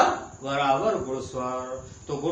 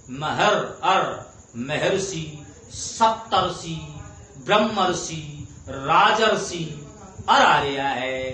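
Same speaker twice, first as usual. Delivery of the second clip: अर punched louder in Maharshi, Saptarishi, Rajarshi; stressed ar sound; 4 dB louder, slower than usual. Shown as a demonstration. Teacher drilling the अर sound in examples. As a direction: hit the अर in each word. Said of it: "अर की ध्वनि आ रही है".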